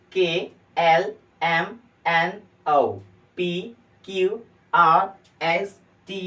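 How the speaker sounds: loud, high letters, each drawn out with a gap; slow, ringing classroom chant; alphabet recitation. Shown as a demonstration. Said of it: "मैं पढ़ने जा रहा हूँ".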